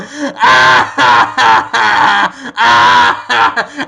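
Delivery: loud, long villain laugh; hammed-up drawn-out "ah-ha-ha"; evil cackle. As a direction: cackle like a triumphant witch. Villain reveals he is a witch.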